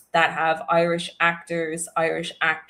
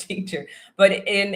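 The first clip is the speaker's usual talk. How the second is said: ordinary talk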